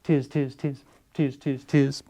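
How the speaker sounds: high, soft monkey chatter. Acted. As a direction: tease him back like little monkeys. Monkeys mimic the peddler.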